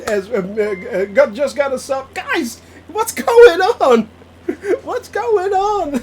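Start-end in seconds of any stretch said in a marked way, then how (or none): 2.1-5.9 laughing disbelief. Real, not acted. Overwhelmed by gifts.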